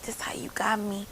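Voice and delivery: whispered, creepy murmur; leering whisper. Retold or imitated. Barber whispering to the client, leering. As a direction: hush it out like a leering man.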